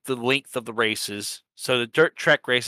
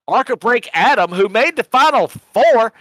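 high, loud hype for his buddy; excited hype; 10 semitones higher, 6 dB louder than usual. Real, not acted. Hyping up a friend who made the Final Four, loud and high. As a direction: cheer him on, voice jumping up.

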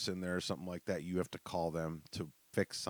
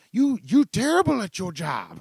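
loud, high, slow mock Vietnamese accent scolding; 14 dB louder than usual. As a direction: play the Vietnamese customer barking at you. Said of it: "some Vietnamese lady that just".

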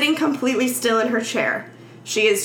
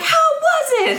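much higher, laughing sing-song teacher; mock teacher voice; 4 dB louder than usual. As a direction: chirp it like an overeager teacher, laughing. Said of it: "In typical teacher fashion".

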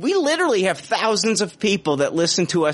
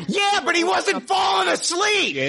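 much higher, shouted comic rant; mock outrage shout; 13 semitones higher than usual. Real, not acted. Host ranting at his sleepy co-host in mock outrage. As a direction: yell it up high in fake fury.

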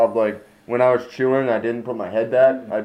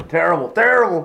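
excited; 9 semitones higher, 6 dB louder than usual. Real, not acted. Laughing at their own look.

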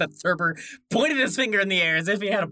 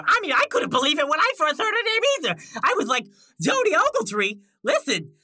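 high, excited character voice; amazed Lil Stragglers; 12 semitones higher, 5 dB louder than usual. Acted. Newcomer amazed by a small world, high and excited. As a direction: marvel at it, pitched high.

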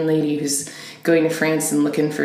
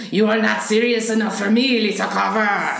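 mock Frenchman; 3 semitones higher than usual. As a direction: play an indignant French suitor with a fake French accent. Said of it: "But in France, they're like".

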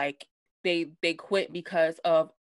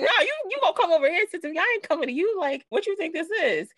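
high, fast mock-indignant retort; indignant refusal; 10 semitones higher, 3 dB louder, faster than usual. [name] refusing to go to Teresa, fast high indignant comeback. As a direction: throw the demand right back at her.

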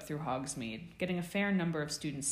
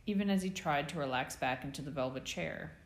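ordinary delivery

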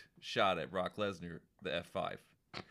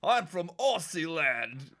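slow mock Australian drawl; Australian accent; 6 semitones higher, 4 dB louder, much slower than usual. Joking about a lazy Aussie gimmick in an Australian accent. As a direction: lay on a silly Australian accent. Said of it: "that's just like".

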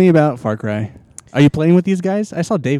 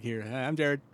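much softer, as if turned away from the microphone; mumbled off-mic Jared; 16 dB quieter than usual. Retold or imitated. Mocking Jared for not talking into the microphone, soft off-mic mumble. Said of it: "Jared isn't talking to the microphone".

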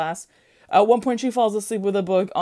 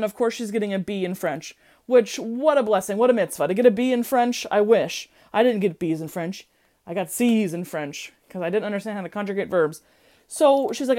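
ordinary talk

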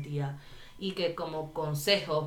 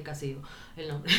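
ordinary delivery

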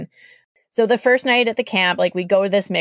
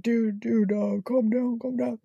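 softer, hushed calming voice; soothing friend; 4 semitones higher, 7 dB quieter than usual. Retold or imitated. Friend hushing the drunk guy to calm down, softly. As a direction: settle your buddy down gently. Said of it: "another voice being like".

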